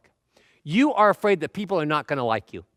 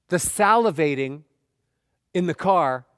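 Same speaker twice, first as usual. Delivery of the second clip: ordinary delivery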